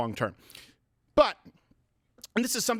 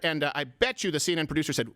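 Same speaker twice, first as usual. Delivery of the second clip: normal talking voice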